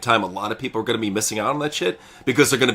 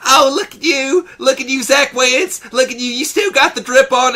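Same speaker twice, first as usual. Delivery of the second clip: mocking Gia Miller; 11 semitones higher, 4 dB louder than usual. Mocking the interviewer to his co-host, high and gushing. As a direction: ham up her fawning over the wrestler. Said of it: "she's like".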